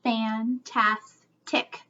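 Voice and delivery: fantastic stretched slowly, fan-tas-tic; slow syllable split. Shown as a demonstration. Teacher slowly chunking the word for kids. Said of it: "what's my third syllable".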